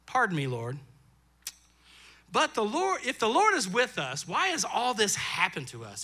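a lightly voiced, questioning Gideon; a hint of a doubtful Gideon pleading with God; Gideon's doubting voice. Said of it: "the vo-, voice inflection".

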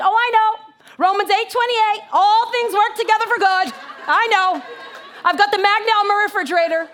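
loud, high know-it-all believer; mock-pious brush-off. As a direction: fire off the verses to shut the comforter up. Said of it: "You know, sometimes we do that".

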